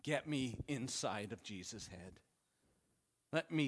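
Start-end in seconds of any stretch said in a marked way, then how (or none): none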